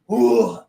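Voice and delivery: put-on loud, high drunken groan.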